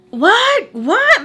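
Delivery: high, loud incredulous What; disbelief. Real, not acted. Hearing he gets triggered, loud high disbelief. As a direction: react like you can't believe it.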